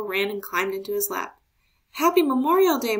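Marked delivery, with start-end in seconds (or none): 1.9-3.0 hearty greeting. A narrator's reading. Grandfather greeting warmly.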